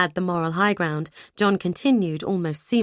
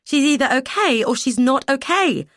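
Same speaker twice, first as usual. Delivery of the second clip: sharply raised, high and loud; daughter's pitch shooting up in disbelief; voice rising; 7 semitones higher, 5 dB louder than usual. Acted. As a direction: demand it, voice jumping up in alarm. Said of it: "My voice rose".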